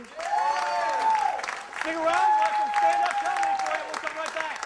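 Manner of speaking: high, loud, drawn-out host announcement; booming showman sign-off; announcer call. Real, not acted. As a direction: sell the break like a TV host. Host announcing break to audience.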